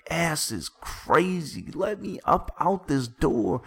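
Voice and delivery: dramatic yell. Acted. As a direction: yell it like an exasperated storyteller. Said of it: "I yell".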